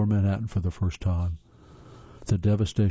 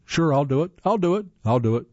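eager volunteering; 6 semitones higher, 3 dB louder than usual. Retold or imitated. Eager volunteers offering to help.

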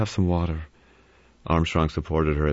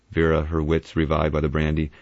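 usual speaking voice

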